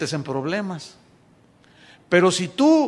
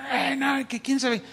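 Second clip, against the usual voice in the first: higher, whiny imitation of a church gossip; mock gossip; 6 semitones higher, 5 dB quieter than usual. Preacher mocking insidious church members, higher mock gossip voice. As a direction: mimic the sly gossip, pitched up. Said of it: "Siempre están diciendo".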